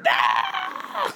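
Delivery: put-on high shrieking child scream; blood shriek. Father imitating his daughter's blood shriek. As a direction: let out a child's wail. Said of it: "she's like".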